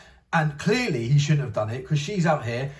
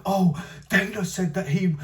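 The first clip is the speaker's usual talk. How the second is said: whiny mocking imitation, pitched higher; mocking Ariel Helwani; 6 semitones higher than usual. Vlogger mocking a podcaster's complaint in a whiny voice. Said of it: "it's like forty minutes of".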